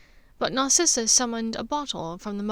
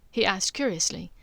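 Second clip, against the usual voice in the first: usual speaking voice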